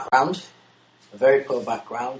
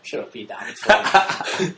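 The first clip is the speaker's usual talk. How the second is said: mock villain voice; 5 semitones higher, slower than usual. Mock insult in silly voice.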